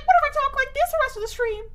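high, fast, squeaky cartoon cookie voice; rapid little squeaky cookie voice; Gingerbread Man voice. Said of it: "I sound like the gingerbread man from Shrek".

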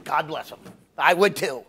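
usual speaking voice

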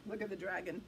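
plain reading voice

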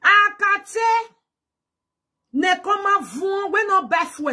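high loud mocking call; hammed-up taunting yell; mock shout. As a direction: shout at the Akate teasingly.

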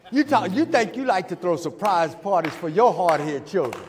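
loud, high preacher's shout; preacher crying out to his church about God's surprise, loud; preaching at full force. Acted. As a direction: build to a shout of glory.

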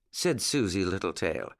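usual speaking voice

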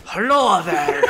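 drawn-out, lipless Irish greeting; no-lips Irish voice. Pretend. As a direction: talk Irish as if you had no lips. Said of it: "he would talk so much different".

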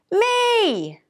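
high, drawn-out self-important cry; interrupting hippo. A narrator's reading. Someone claiming the thanks from the band, interrupting. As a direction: butt in with a long high note.

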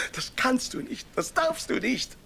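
high, pleading, almost breaking; high desperate plea. Acted. Husband begging his wife not to leave, high and desperate. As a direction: beg her not to go.

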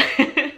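short high giggle; embarrassed laugh. Real, not acted. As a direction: laugh it off, flustered. Embarrassed after crying on camera.

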